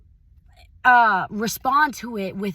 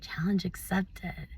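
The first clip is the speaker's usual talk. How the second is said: whispered; 7 dB quieter than usual. Retold or imitated. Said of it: "I was like".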